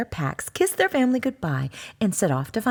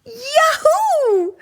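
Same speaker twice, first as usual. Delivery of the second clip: much higher and louder, whooping cheer; a little cub cheering; delighted high yahoo; joyful cry; 7 dB louder than usual. Acted. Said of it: "cried Little Cub".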